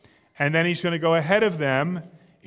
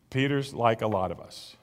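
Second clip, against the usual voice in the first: normal talking voice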